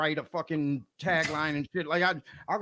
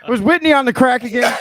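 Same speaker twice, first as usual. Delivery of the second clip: much louder, higher-pitched repeat; 5 semitones higher, 11 dB louder than usual. Real, not acted. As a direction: repeat it loudly so it gets heard. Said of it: "say that again?".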